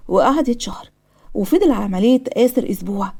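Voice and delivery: usual speaking voice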